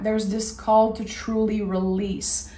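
normal talking voice

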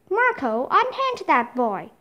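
ordinary delivery

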